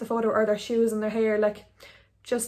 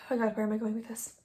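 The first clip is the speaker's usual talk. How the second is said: muttered aside to self; 8 dB quieter, faster than usual. Real, not acted. Loses her thread, talks to herself.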